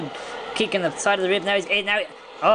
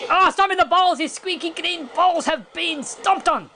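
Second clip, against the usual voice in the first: shocked excited commentary; 11 semitones higher, slower than usual. Real, not acted. Commentator reacting to a stomp in the balls, shocked excitement. Shocked call of low blow.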